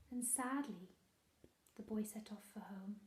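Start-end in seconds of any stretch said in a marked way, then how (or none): 1.7-2.9 whisper. Acted. Sad ending told in hush.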